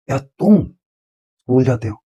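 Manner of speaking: तुम jumps very high, then school जाते हो falls; single out तुम with a high rise; stress on तुम. Shown as a demonstration. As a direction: point at the listener by stressing तुम. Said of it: "मैंने stress के साथ".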